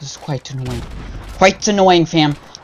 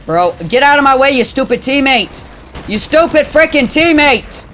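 higher, louder, fast angry yell at a teammate; 8 semitones higher, 3 dB louder than usual. Real, not acted. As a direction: snap furiously at the teammate in the way. Yelling at teammate blocking him.

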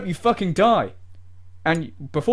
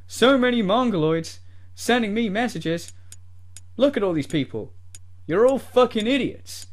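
usual speaking voice

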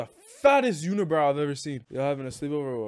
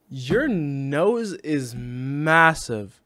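very slow, louder, stretched words; drawn-out roast; 5 dB louder, much slower than usual. Real, not acted. Streamer teasing a stranger about their nose, drawn-out roast. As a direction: drag every word out for the insult.

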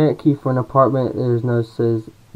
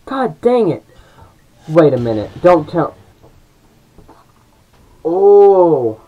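berserk rage; 5 semitones higher, 8 dB louder, slower than usual. Real, not acted. Frantic fight with game enemy.

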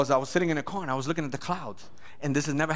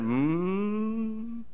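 normal talking voice

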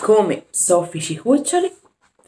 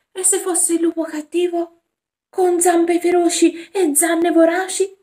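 put-on higher, fearful child's voice; scared little boy; 9 semitones higher, 4 dB louder than usual. Jack asks Alice, scared of the wolf, higher fearful voice. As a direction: squeak it out like a frightened boy.

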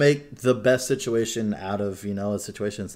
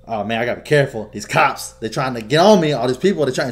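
louder, higher, jumpy paranoid voice; hammed-up paranoid street hustler; paranoid dealer act; 4 semitones higher, 10 dB louder than usual. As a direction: play a high, paranoid drug dealer. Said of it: "So I'm walking around like".

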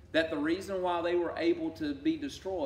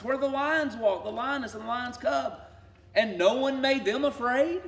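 high, loud taunting voice of God jeering at the proud; God mocking; 8 semitones higher, 5 dB louder than usual. God taunting Assyria's lions, high and loud. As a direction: sneer it out like a mocking victor. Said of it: "And he is saying".

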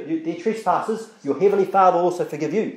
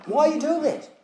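higher-pitched voice of a mild accuser; lightly voiced challenge, raised in pitch; critic pointing out wrong; 7 semitones higher, faster than usual. Someone points out another's wrongdoing, mildly accusing. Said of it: "when someone points it out".

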